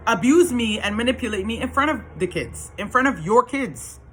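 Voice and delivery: retold angry accusation. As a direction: replay confronting an ex. Replays arguing back at ex.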